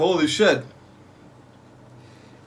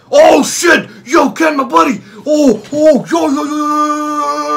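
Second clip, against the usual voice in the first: loud high-pitched yelling; excited shouting; 10 semitones higher, 7 dB louder than usual. Real, not acted. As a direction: scream at the big play. Tense point in match.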